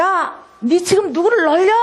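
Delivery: high, slow imitated outrage; angry creditor. Creditor who threw the bill at the debtor's face, mock furious yell. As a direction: act out an indignant yell, stretching the words. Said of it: "이 사람이 화가 너무가 났어".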